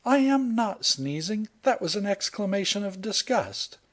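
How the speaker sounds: put-on high, offended young woman's voice; huffy heroine. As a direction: deny it with wounded dignity. Heroine indignant denial.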